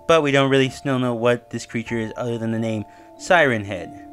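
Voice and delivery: ordinary delivery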